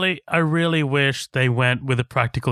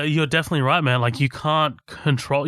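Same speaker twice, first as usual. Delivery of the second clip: ordinary delivery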